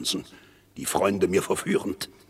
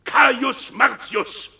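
viel höher, zuletzt sehr langsam gedehnt; verächtlicher Ruf; 5 dB louder, slower than usual. Acted. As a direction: dehne den vollen Namen voller Hohn. Höhnische Namensnennung im Streit. (Ankläger dehnt den Namen verächtlich viel höher)

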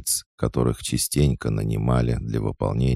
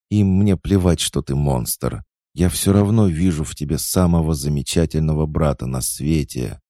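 passionate; 5 semitones higher, 5 dB louder than usual. Acted. Tearful girl declares her love.

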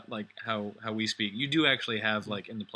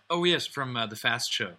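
ordinary talk, nothing marked

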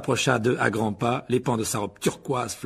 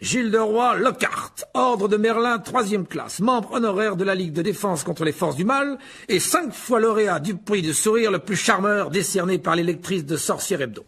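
Lockhart, pompous; 8 semitones higher, 4 dB louder than usual. Acted. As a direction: proclaim your titles with vain relish. (présentation pompeuse et vaniteuse)

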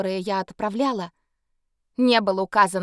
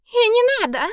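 high, loud witch shriek; 13 semitones higher, 4 dB louder than usual. Acted. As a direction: squeal it like a furious witch. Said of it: "взвизгнула ведьма".

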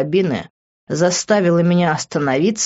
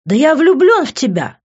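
much higher and louder; desperate shout; 9 semitones higher, 6 dB louder than usual. A narrator's reading. Vincent shouts his love after leaving Liv. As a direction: yell it after her. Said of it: "крикнул ей вслед Винсент".

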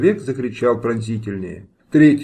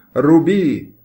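shouted order; 4 semitones higher, 5 dB louder than usual. A narrator's reading. Nobleman signals the guards.

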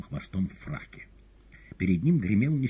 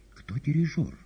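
high-pitched question in passing; raised, high woman's query; calling question; 9 semitones higher than usual. A narrator's reading. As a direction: call it out high as you fly away. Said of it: "отлетая, спросила Маргарита".

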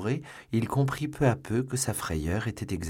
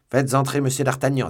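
loud, raised-pitch command; commanding order; 6 semitones higher, 8 dB louder than usual. A narrator's reading. Commissioner has guards bring in a prisoner, commanding.

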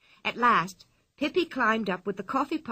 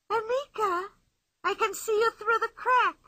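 put-on playful girl boasting from inside the tree; Pippi's voice; 12 semitones higher than usual. A narrator's reading. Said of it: "cried Pippi".

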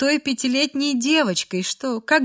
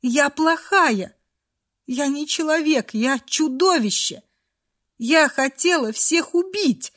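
higher-pitched, anguished self-accusation; sobbing confession; 4 semitones higher than usual. A narrator's reading. Girl calls herself a monster, sobbing. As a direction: wail it in short, tearful bursts. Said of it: "навзрыд говорила Фрея".

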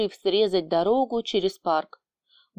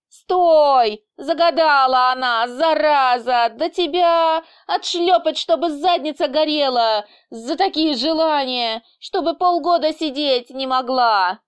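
loud, high outraged rant; indignant yelling; 6 semitones higher, 5 dB louder than usual. A narrator's reading. Boss threatens Nelya while chasing her, indignant yelling. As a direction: yell after a runaway in outrage. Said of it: "возмущался босс".

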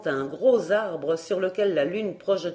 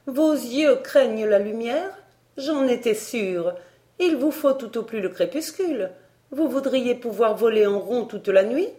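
higher, scornful needling; taunting; 5 semitones higher, 4 dB louder than usual. Acted. Barbara mocking the tutor's weak eyes, taunting. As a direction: taunt him, voice pitched well up.

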